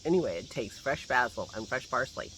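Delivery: ordinary talk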